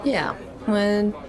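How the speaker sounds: ordinary talk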